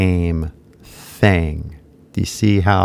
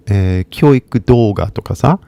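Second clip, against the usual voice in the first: usual speaking voice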